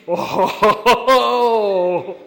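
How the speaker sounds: loud high "Oh" bursting into laughter; gleeful laugh. Retold or imitated. The note-taker at the sleepover, loud laughing "Oh". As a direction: gasp then cackle with delight. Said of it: "who's like".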